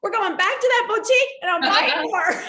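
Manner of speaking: loud, high, thrilled shout to her friend; rally her girlfriend back to the shop; whooping with delight; excited call. Retold or imitated. Excited to buy more shoes.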